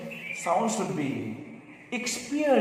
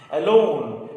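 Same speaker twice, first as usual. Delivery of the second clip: ordinary talk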